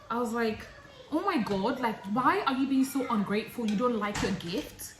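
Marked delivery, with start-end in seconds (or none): 1.1-4.9 louder, indignant oh my God; offended retort. Retold or imitated. Girlfriend scolding the boyfriend for being ungrateful, loud and indignant. Retold quarrel, she's offended.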